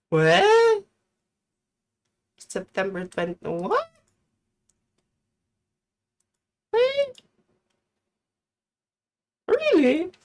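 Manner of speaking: high-pitched astonished questioning; real disbelief. Real, not acted. Streamer reacting to chat's September date, astonished.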